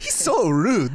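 put-on laughing Japanese accent. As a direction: Japanese accent, giggling through it. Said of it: "my Japanese accent came off kind of Italian".

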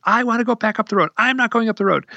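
high-pitched retold bickering; mock argument. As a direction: imitate a couple squabbling, pitch held up high. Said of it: "they get a huge argument".

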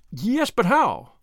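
desperate to know the way forward; eager cry. A narrator's reading. Tuppence pressing the lawyer for a plan, eager cry. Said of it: "cried Tuppence".

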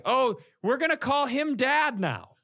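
ordinary talk, nothing marked

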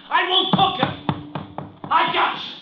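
high furious shouts at a thief; angry yelling. Acted. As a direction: yell it while chasing him.

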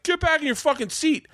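high-pitched mock yell; crowd heckling back. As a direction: imitate a crowd ordering someone down. Imitates crowd yelling at heckler.

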